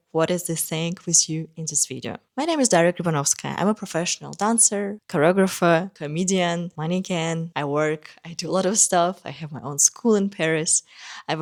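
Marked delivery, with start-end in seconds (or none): none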